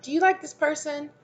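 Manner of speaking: very high, leading question; acted high-pitched probing friend; manipulative friend. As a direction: pitch it way up, fishing for an opinion. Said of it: "a type of friend or something".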